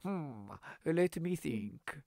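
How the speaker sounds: slow, soft, considering hum; pondering old man. Retold or imitated. The Russian dude weighing the request, soft and slow. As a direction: stall like a cautious old man. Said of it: "he's like".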